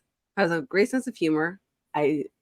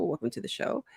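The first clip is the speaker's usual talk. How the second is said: a soft, higher echo of the welcome; 4 semitones higher, 12 dB quieter, faster than usual. Acted. Host echoing her own show welcome, soft and higher. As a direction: repeat it quietly like a studio echo.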